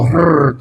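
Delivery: trilled R purr; showy tongue-rolled "Hrr". Shown as a demonstration. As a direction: roll the R in a long trill. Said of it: "how to roll my R's".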